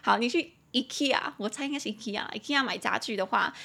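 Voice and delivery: ordinary talk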